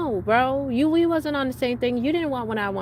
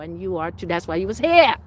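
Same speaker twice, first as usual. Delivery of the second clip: usual speaking voice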